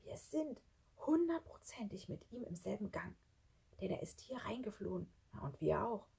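emphatic but hushed whisper; soft, secretive warning. Acted. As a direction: insist under your breath. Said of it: "flüsterte Schlunz".

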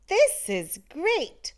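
happy snowman; delighted snowman. A narrator's reading. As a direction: exclaim it with delight. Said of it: "the snowman cried".

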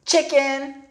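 put-on high, loud jeering girl; taunting girl. A narrator's reading. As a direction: call him a chicken. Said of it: "said Muffy".

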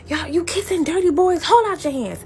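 high imitated Caribbean scolding; Caribbean mother accent. Mother ordering daughter's hands out, Caribbean-accented scolding. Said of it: "Sorry, I lost my accent".

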